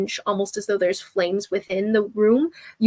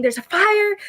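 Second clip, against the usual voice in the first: a raised shout of alarm; 11 semitones higher, slower than usual. Retold or imitated. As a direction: cry out the warning. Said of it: "they hear you shouting".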